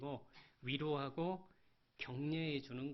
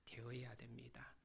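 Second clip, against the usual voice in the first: whisper; 4 semitones lower, 13 dB quieter than usual. Real, not acted. Whispered sermon close.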